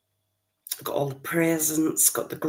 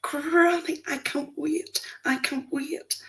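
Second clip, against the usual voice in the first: put-on much higher, eager child's voice fading to a whisper; excited little grandson; 11 semitones higher, slower than usual. Granny imitates her grandson who can't wait for Christmas, high and breathless. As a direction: mimic the wee boy bursting for Santa. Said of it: "one of them comes running up to me and goes".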